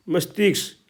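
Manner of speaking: usual speaking voice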